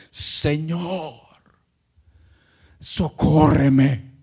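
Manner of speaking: low, slow, halting supplication; weighty low plea with long pauses; humbled pleading. Retold or imitated. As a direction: beg humbly at his feet, drawing out each word. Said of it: "humillada, pero mirándolo, buscando su rostro".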